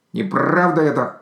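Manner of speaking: high, loud indignant protest; an offended outburst. A narrator's reading. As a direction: bristle at the accusation. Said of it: "возмутился Сарачун".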